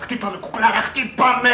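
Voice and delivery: high mock begging, rising to loud; hammed-up lover in a frenzy; eager film lover. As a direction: imitate a man pleading excitedly. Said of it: "Αυτός".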